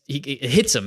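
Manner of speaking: usual speaking voice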